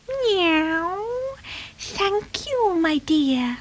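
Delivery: high, soft, drawn-out cat voice; silky high-pitched feline purr. Acted. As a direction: purr your thanks like a grateful cat. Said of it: "she purred".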